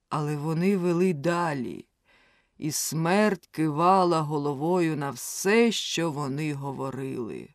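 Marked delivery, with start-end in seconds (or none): none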